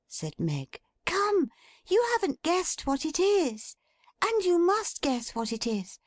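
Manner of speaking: put-on high, bright teasing girl's voice; playful Meg. A narrator's reading. Meg teases her father to guess the dish, playful.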